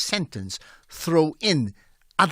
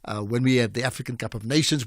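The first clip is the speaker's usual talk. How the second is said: usual speaking voice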